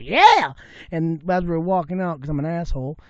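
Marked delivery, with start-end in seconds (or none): 0.0-0.5 high, loud echoed holler; mocking shout back. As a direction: throw his holler right back at him. Retold mocking shout back at local.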